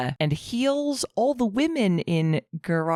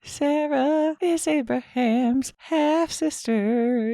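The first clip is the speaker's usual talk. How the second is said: high and slow, every word weighed; deliberate dramatic confirmation; slow emphatic confirmation; 9 semitones higher, much slower than usual. Real, not acted. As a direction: spell it out slowly for effect.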